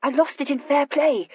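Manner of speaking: high, stammering impatient retort. A narrator's reading. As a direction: correct him impatiently. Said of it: "Horatia replied impatiently".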